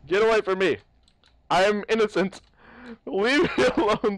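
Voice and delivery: loud, frantic pleading shouts; panicked yelling. Real, not acted. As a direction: yell him off. Yelling at Slender chasing him.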